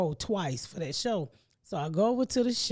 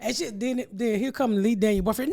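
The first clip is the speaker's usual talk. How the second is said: normal talking voice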